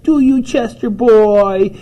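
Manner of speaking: put-on high, loud, drawn-out baby talk; cooing pet talk. Owner talking to her cat, high sing-song cooing. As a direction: coo it slowly at a pet.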